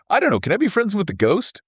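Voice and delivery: high, eager, wide-eyed voice; hopeful Cody quote. Retold or imitated. Host quoting Cody asking to befriend the ghost, high and eager. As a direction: lift it way up like a hopeful kid. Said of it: "Cody, ever the optimist, was like".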